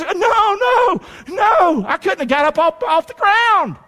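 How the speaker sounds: high-pitched protest, voice leaping up; overwhelmed refusal. Acted. Preacher imagining being asked to baptize Jesus, high overwhelmed refusal. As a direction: cry out in hammed-up awe.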